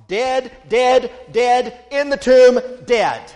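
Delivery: dead struck hard and loud, slowing on in the tomb; hammered repetition. Real, not acted. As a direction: pound the word dead again and again. Preacher stressing Jesus was dead.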